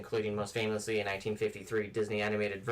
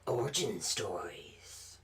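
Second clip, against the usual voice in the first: movie trailer voice; 5 dB quieter, much slower than usual. Acted. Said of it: "That was creepy".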